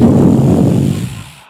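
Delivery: loud mock shriek. Reaction to huge reveal.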